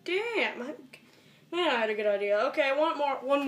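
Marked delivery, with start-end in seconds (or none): none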